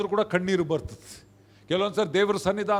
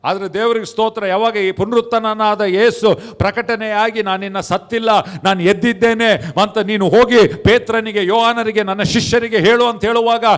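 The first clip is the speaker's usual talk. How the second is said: loud, driving sermon delivery; impassioned preaching; 5 dB louder than usual. Real, not acted. Preacher tells congregation of risen Jesus, impassioned and loud. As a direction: preach the good news with rising force.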